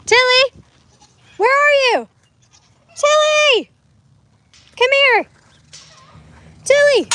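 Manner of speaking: high, loud repeated calls; calling out. Real, not acted. Owner calling her goat Tilly across the pasture, loud high calls.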